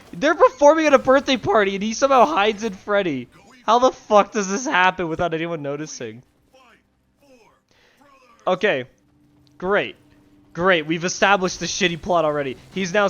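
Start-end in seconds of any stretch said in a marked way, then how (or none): 0.1-6.2 loud, high outraged rant. Real, not acted. Outraged at plot hole. 8.5-10.9 sarcastic. Real, not acted. Sarcastic after plot rant.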